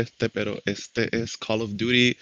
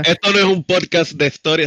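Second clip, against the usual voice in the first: exasperated; 6 semitones higher, 6 dB louder than usual. Real, not acted. Cuts off history argument, exasperated.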